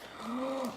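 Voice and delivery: quick shocked intake of breath; sharp gasp. Real, not acted. Finding an embarrassing photo, she gasps. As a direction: gasp at the sight. Spots a really bad old photo.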